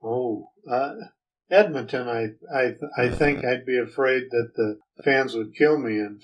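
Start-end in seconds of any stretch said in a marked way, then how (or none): none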